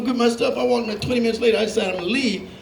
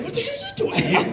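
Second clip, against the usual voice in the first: much higher, squeaky disbelief; mock baffled high voice. Comic staring back at the Mexicans, squeaky and baffled. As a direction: squeal the question back at them.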